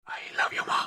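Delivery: breathless whisper of love; husband whispering I love you to his wife; whispered confession. Acted. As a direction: whisper it close to her ear.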